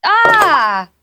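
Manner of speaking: high outraged yell; disgusted outraged yell. Acted. Stepped in dog poop.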